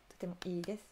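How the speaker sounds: approbation soufflée sans voix; chuchoté. Acted. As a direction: murmure le compliment. (approbation à voix chuchotée)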